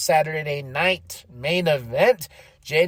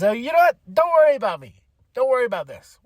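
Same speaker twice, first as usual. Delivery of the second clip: high, raised-voice imitation of testy reassurance; mock brush-off; 8 semitones higher, 4 dB louder than usual. Imitating Finn in backstage conflict with Liv, mock brush-off. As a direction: wave them off, pitched up and insistent.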